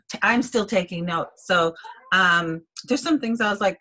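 ordinary talk

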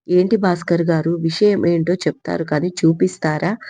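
ordinary delivery, nothing marked

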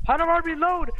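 high panicked question; panicked shout. Real, not acted. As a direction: yell it in panic. Panicking under attack.

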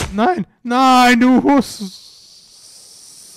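shrieking protest; outraged shout. Real, not acted. Game fails, outburst at it.

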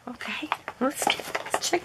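soft whispered aside; hushed whispered okay. Real, not acted. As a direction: whisper to yourself. Moving to next item.

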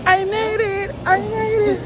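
ordinary delivery

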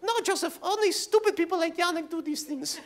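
high-pitched mock Russian accent, scolding; imitated Russian stepmother. Russian stepmother warning Joseph off flying lessons, mock-scolding Russian accent. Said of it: "my stepmother, who's also Russian, she's like".

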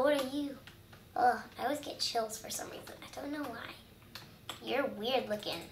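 ordinary delivery

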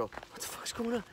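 high, soft panicked question; acted panic. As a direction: act out stunned alarm after an attack. Acts out shock after being attacked.

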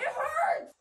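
high, soft squeal of pain; pained whine. Real, not acted. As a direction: whimper it high from the needle. Complaining during tattoo.

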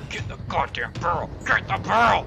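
rising panic as the enemy nears; building panicked yell. Real, not acted. Urging himself to hide.

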